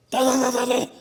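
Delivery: high rapid gibberish rant; mock high-pitched tirade; imitated yelling. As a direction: mimic someone yelling in nonsense syllables. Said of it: "he started yelling".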